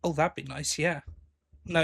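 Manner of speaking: ordinary talk, nothing marked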